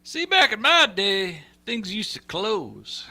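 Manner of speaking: put-on slow, high grumpy old man; old-timer voice. Asked about his heyday, he answers as a slow old-timer. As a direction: play the crusty old-timer remembering the good days. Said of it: "Tell me about your heyday there, Pops".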